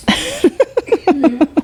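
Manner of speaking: laughing high and breathy. Real, not acted. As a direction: burst out laughing. Laughing while cohost chokes.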